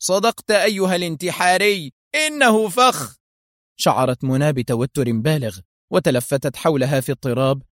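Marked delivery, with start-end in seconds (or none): 0.0-3.2 mock him, savouring each word; sarcastic. A narrator's reading. Foster over loudspeakers mocking the trapped hero. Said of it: "وهو يقول في سخرية".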